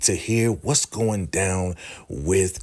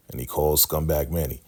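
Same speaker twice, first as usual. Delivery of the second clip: usual speaking voice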